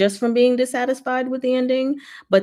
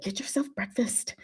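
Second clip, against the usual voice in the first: whispered aside to herself; 4 semitones higher, 10 dB quieter than usual. Real, not acted. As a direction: hush it like a secret reminder.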